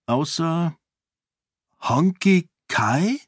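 high, slow questioning rise; drawn-out, uncertain question; hesitant. A narrator's reading. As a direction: ask it tentatively, unsure of the name. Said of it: "Fragte sie zögernd".

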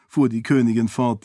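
ordinary delivery

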